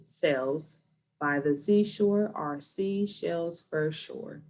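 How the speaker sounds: slow, deliberate word by word; slowed-down tongue twister. Shown as a demonstration. Teacher reading slowly to students, slow and careful. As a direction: read it slowly so kids can follow. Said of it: "let's slow down in the beginning".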